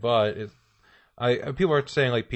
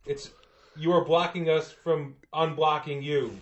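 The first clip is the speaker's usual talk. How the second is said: higher-pitched, raised driver voice; snowplow driver; 5 semitones higher, slower than usual. Acted. Plow driver asking resident to move, calling out. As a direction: call out to someone blocking the road.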